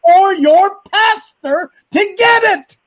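high-pitched preacher's shout; impassioned rant. Real, not acted. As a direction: shout it out, stretching the key words. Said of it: "that's a rant".

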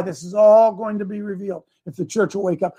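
ordinary talk

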